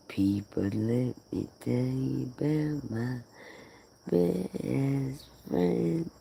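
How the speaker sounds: breathy stage whisper to the audience. Acted. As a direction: lean in and whisper the secret. Confiding to the viewers.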